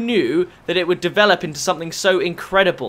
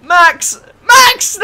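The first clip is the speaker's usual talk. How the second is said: high, loud yell to a teammate; screamed plea; shouting in dismay; 10 dB louder, much slower than usual. Real, not acted. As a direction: cry out in real panicked disbelief. Calling out to teammate mid-play.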